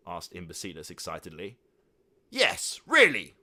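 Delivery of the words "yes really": loud, high joint exclamation; exclaimed. A narrator's reading. Three uncles exclaiming in unison to the nephew. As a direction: shout it together. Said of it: "exclaimed in unison".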